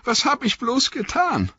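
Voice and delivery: very high remorseful wail; distraught cry, pitch far up; anguished cry. A narrator's reading. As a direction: wail in regret after weeping. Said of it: "rief er".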